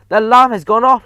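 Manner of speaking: high, loud triumphant shriek. A narrator's reading. Witch to her audience, loud gleeful shriek. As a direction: crow with delight. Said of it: "shrieked the Grand High Witch".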